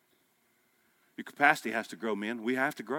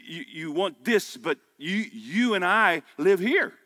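raised, slowed preaching with a hint of heat; 6 semitones higher, 4 dB louder than usual. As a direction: push the point home to the listeners. Preaching about marriage and work.

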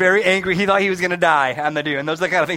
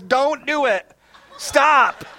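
higher, hammed-up little girl's alarmed warning, with a laugh; mock horrified girl; 6 semitones higher, much slower than usual. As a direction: play the scared kid pleading, half laughing. Horrified ten-year-old girl warning.

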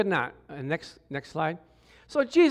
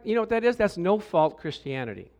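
ordinary delivery

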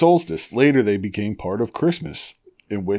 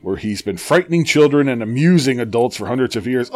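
ordinary talk, nothing marked

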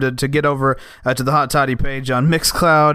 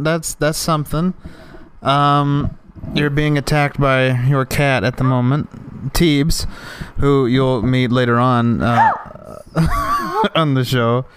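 ordinary delivery

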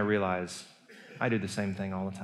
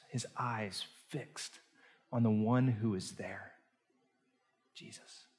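hushed suspense; 6 dB quieter than usual. A narrator's reading. Suspenseful retelling of the steps.

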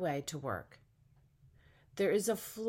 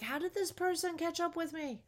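much higher, astonished; amazed; 11 semitones higher than usual. Pretend. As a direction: gasp it out in wonder. Imagined amazed reaction quote.

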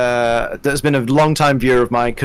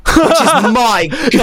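laughing; 8 semitones higher, 4 dB louder, slower than usual. Real, not acted. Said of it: "laughing after quote".

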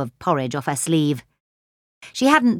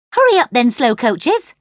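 much higher, louder teasing call; calling over shoulder; 5 semitones higher, 4 dB louder than usual. Acted. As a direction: call back to the slowpokes behind you. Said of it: "she called over her shoulder".

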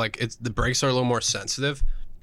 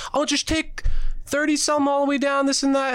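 much higher, breezy babble of directions; rambling directions; 14 semitones higher than usual. Retold or imitated. To the driver, a high breezy babble of directions. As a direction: rattle it off like a know-it-all. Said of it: "I'd be like".

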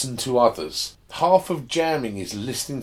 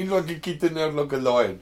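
put-on laughing Afrikaans accent, pitched up; playful South African instructor voice; broad South African accent; 5 semitones higher than usual. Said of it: "put it in a, an Afrikaans accent and you're there".

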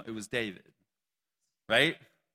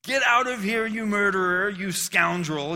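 higher, louder accusing shout; loud, angry cry of a bitter accuser; shouted insult; 6 semitones higher, 6 dB louder than usual. A narrator's reading. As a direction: hurl it at the king like a curse. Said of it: "he shouted at David".

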